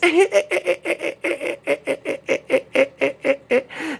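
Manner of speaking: high cackling laughter; mocking giggle. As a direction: laugh at him like the women. Women laughing at him.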